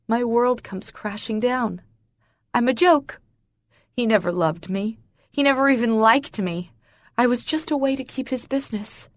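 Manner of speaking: usual speaking voice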